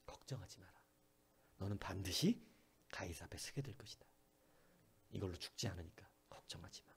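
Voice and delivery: calm him like a whisper in the storm; tender whisper. Acted. The Lord reassuring Paul in the storm with a soft whisper.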